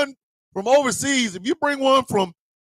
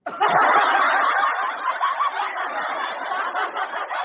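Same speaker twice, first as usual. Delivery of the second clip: wheezing laugh. Real, not acted. Cracking up at the story.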